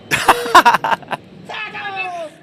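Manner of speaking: high, shouted Liverpool accent; exaggerated Scouser. Shown as a demonstration. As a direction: yell it in over-the-top Scouse. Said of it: "exaggerated version".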